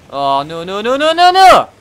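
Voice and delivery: rising panic as it grabs him; panicked no's. Real, not acted. Grabbed by a monster, panicking.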